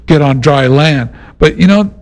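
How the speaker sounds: ordinary talk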